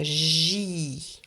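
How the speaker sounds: "J" held long as "jiii"; slow, sustained "J" syllable; syllable stretched. Shown as a demonstration. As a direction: draw out "J" so each sound is heard. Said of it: "Répétez bien chaque syllabe".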